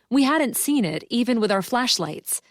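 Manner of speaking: usual speaking voice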